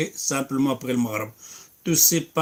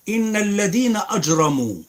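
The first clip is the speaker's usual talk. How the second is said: fast, raised chanted recitation of the verses; louder melodic tajwid recitation; Quran recitation; 6 semitones higher, 3 dB louder, much faster than usual. Acted. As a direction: recite like the imam, quick and melodic.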